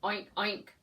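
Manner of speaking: playful pig call; pig grunt. Acted. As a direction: oink it out for the kids. Pig grunts in picture book.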